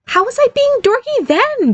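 very high-pitched objection; affronted question, pitch way up; indignant protest. Acted. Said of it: "Barry protested".

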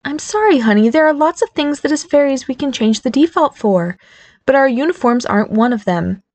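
sympathetic but stern. A narrator's reading. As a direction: apologize but hold the line. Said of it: "both sympathetic and stern".

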